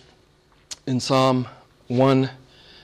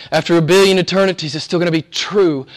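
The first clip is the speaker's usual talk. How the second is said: ordinary talk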